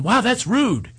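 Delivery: high, prim mock of an offended stranger; imitating a prissy offended moviegoer. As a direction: play the huffy talker. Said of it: "they're basically going".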